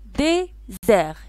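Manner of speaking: DÉSERT slowly split into dé and zert; clapped syllables. Shown as a demonstration. Teacher claps the word désert for pupils, syllable by syllable. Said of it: "Frappez-moi le mot désert".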